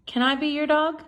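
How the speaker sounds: usual speaking voice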